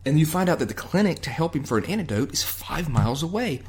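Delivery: normal talking voice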